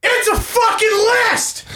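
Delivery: mock shout. Mock outburst at a list.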